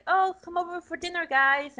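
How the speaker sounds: calling to dinner. Retold or imitated. Roger calling the family to dinner.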